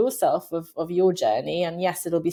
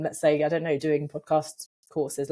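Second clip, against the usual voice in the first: ordinary delivery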